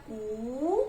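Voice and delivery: कू with the "oo" drawn out; sounding out कू slowly; long vowel. Shown as a demonstration. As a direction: stretch the end of कू long. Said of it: "कैसे पढ़ेंगे?".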